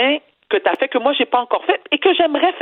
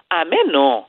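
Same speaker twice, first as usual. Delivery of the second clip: put-on dismissive mother, Haitian accent; 6 semitones lower, slower than usual. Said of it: "je vais faire l'accent de ma mère".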